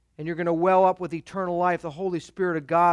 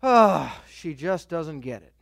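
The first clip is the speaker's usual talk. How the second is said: sighing; 5 semitones lower, slower than usual. Acted. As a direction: sigh it out, acting resigned to her not understanding. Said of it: "And we sigh and go".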